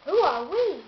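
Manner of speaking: high, loud offended cry; indignant cry. A narrator's reading. Donald Duck crying out, offended at not being known. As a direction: cry it out, affronted. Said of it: "cried Donald Duck".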